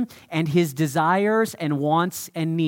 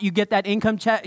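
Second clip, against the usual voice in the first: normal talking voice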